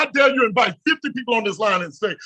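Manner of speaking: normal talking voice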